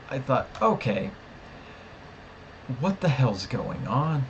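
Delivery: ordinary delivery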